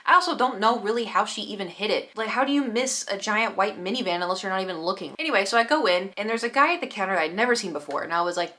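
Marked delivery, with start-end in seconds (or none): none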